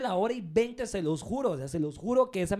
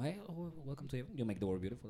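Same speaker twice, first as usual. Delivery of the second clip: much lower and softer greeting; deep man voice; 7 semitones lower, 13 dB quieter than usual. Retold or imitated. Agent greeting a male caller in a deep put-on voice. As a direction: drop the voice way down to answer a man. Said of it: "la voz".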